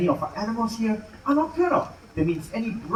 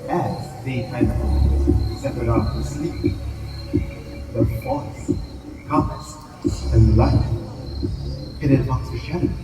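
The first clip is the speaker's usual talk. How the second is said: documentary show narration; 3 dB quieter, slower than usual. A narrator's reading. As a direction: draw the audience into the night, slowly. Show narration over music.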